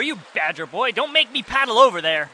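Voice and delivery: normal talking voice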